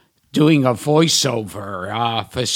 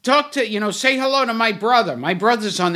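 lightly voiced woman, raised pitch; mock-eager woman on the payphone, higher; imitating the woman; 7 semitones higher, faster than usual. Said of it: "and she goes".

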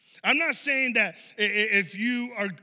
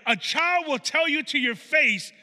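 ordinary delivery

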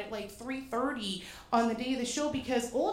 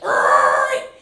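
startled group yell; 13 dB louder than usual. Retold or imitated. Brakes slammed, everyone yells.

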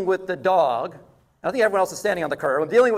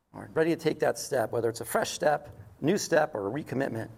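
closing prayer; 4 semitones lower than usual. Acted.

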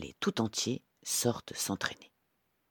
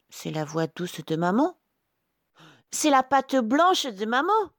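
high little-goat voices growing louder; exclaiming kids; 6 dB louder than usual. A narrator's reading. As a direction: grow more convinced, as little goats. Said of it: "s'exclament les chevreaux". (chevreaux émerveillés, voix aiguë)

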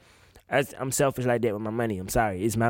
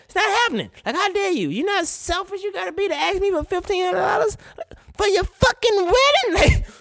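much higher and louder, indignant; how dare you, pitched up in outrage; outraged disbelief; 3 dB louder than usual. Real, not acted. Host ranting at a greedy groom, high outraged disbelief.

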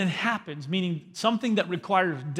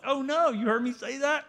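high, caught-out gasp of a voice; mock dismay; 8 semitones higher than usual. Parent realising the child heard them, in mock high dismay. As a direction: act the parent suddenly realising they were overheard.

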